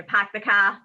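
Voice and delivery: put-on a light Boston accent, dropped r's; Mark Wahlberg Boston accent. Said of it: "Like Mark, Mark Wahlberg".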